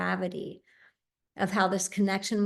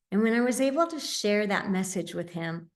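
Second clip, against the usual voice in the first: usual speaking voice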